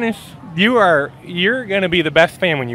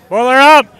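"Boiler up!" shouted louder and higher as a confident chant; proud cheer; 6 semitones higher, 7 dB louder than usual. Real, not acted. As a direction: belt out the chant for real. Full cheer after the trial.

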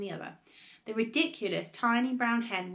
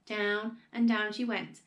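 usual speaking voice

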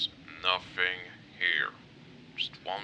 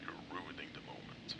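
whispered scolding, very soft; barely audible, whispered complaint; hushed reproach; 12 dB quieter than usual. Acted. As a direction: hiss it under the breath. Hushed reproach after shushing.

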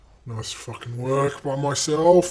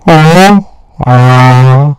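mic test shout; 24 dB louder, much slower than usual. Shown as a demonstration. Streamer testing how the mic sounds, loud shout. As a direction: blast a hello to test the mic. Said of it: "How does this sound in the mic?".

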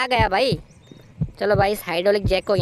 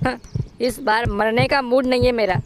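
ordinary delivery

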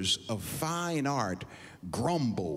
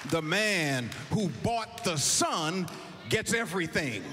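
preaching; 7 semitones higher than usual. Acted. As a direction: drive the point home to the congregation. Preacher drives the point home.

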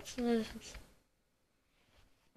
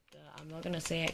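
usual speaking voice

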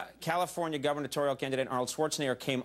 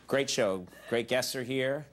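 ordinary talk, nothing marked

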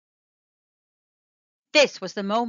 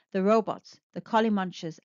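usual speaking voice

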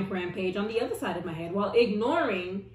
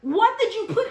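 indignant retort; 10 semitones higher, 6 dB louder than usual. Retold or imitated. Teller retorting at the stylist, high and laughing. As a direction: fire it back high and incredulous.

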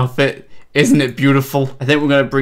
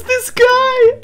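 very high, louder squealed exclamation; amused squeal; 5 dB louder than usual. Real, not acted. Streamer delighted by a funny player, high squeal.

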